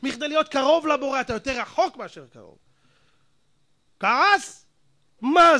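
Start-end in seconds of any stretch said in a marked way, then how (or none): 0.0-2.0 higher, louder hammering sermon; fiery preaching. Acted. Rabbi rebukes his listeners about Torah, shouting high. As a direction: thunder at the congregation.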